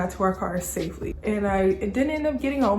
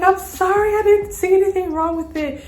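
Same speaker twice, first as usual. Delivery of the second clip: high, loud, pleading excuse; imitated whiny apology; 12 semitones higher, 7 dB louder than usual. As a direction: mimic a friend's defensive apology. Friend's acted apology after scolding.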